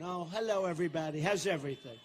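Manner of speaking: mocking the nice tone he's told to use. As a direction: play the sweet, well-behaved candidate, sarcastically. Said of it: "I'm supposed to have a nice tone".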